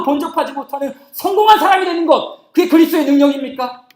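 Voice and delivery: loud, high, driving rhetorical question; full-force preaching. Real, not acted. Preacher challenging a success-minded idea of Christ's power, preaching forcefully. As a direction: thunder the challenge at the congregation.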